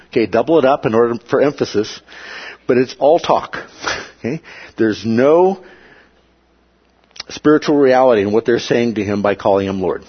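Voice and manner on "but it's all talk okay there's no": usual speaking voice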